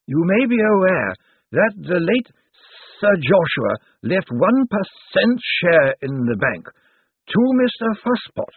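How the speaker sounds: put-on slow, high, dusty old lawyer; zombie lawyer voice. A narrator's reading. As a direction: draw out each word like a zombie. Slant speaks.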